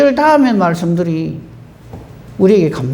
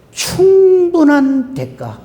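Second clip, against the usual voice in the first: 충분한 대가 drawn out slowly and pitched higher; stressed key phrase; 8 semitones higher, much slower than usual. Real, not acted. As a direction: lean hard on 충분한 대가, word by word. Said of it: "그 다음 말이 매우 중요합니다". (충분한 대가를 느리고 높게 힘주어)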